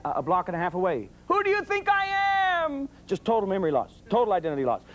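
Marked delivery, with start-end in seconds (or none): 1.3-2.9 mock scream. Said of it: "I've heard 'em screaming".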